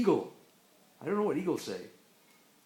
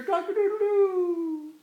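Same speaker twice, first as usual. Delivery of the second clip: slow, high, loud crow; rooster crow; 10 semitones higher, 7 dB louder, slower than usual. Pretend. Parent saying it's a rooster, then long high crow. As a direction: draw out a high cock-a-doodle-doo.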